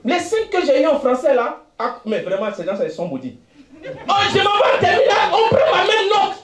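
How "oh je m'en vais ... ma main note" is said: laughing shout. Retold or imitated. Telling of the exam result.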